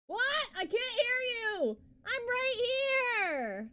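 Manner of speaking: calling out. Real, not acted. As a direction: call out that you're right here. Shouting through voice filter.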